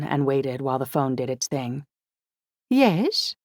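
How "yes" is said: high, nasal one-word answer; nasal female voice. A narrator's reading. Woman picking up a caller's phone call, nasal. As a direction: pitch it up high through the nose. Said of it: "answered a nasal female voice".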